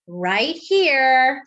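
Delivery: ordinary delivery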